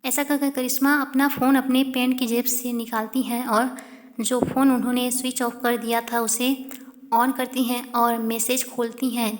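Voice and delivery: usual speaking voice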